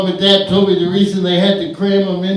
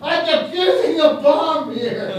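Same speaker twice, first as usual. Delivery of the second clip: mock movie hero; 10 semitones higher, 7 dB quieter than usual. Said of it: "some character just turned around and went".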